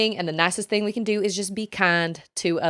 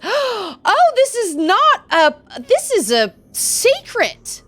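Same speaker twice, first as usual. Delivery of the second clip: high, slow, loud amazement; gasp, then stretch out the discovery; gasping surprise; 13 semitones higher, 7 dB louder, much slower than usual. Real, not acted. Discovers a secret in game.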